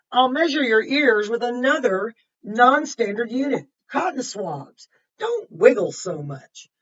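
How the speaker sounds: lively picture-book narration; reading to children. A narrator's reading. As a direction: read it to the class with energy. Reads Lisa's story aloud.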